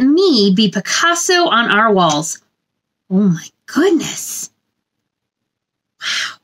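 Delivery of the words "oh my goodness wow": ordinary delivery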